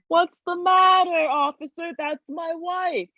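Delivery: high, slow, sugary innocent voice; a husband fibbing to the officer, high and slow. Retold or imitated. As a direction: play it sweet and innocent to fool the cop. Husband's cheerful lie to cop.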